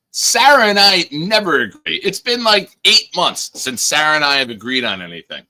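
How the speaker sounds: high, loud exasperated complaint; exasperated outburst. Real, not acted. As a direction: throw up your hands at the chat. Mock complaint to chat.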